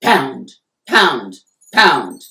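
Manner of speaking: loud mock knocks; knocking on the wall. A narrator's reading. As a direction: pound it out like fists on a wall. Neighbor banging on wall.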